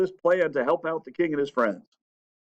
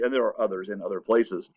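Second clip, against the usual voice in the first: ordinary talk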